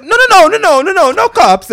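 high, loud, frantic no no no; cartoon Italian panic. Scammer fleeing the cops, yelling high and loud like a cartoon. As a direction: yell like a flailing cartoon Italian. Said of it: "flailing like an Italian cartoon".

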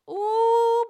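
high, loud gleeful ooh; delighted ooh. Real, not acted. Host relishing Bravo shading Karen, gleeful ooh. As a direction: savor the shade with a big ooh.

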